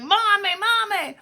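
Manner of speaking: put-on high, excited child's call; little boy calling. Walker calls his mommy from the porch, high.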